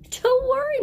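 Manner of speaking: high comforting "Don't worry"; reassuring. A narrator's reading. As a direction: comfort the sad puppy. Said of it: "said Ben".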